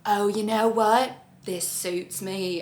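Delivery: lightly voiced, slow and content; mock serene acceptance. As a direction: savour the line as if sold on it. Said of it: "she's gonna be like".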